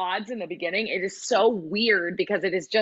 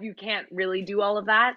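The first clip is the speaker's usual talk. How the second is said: ordinary talk, nothing marked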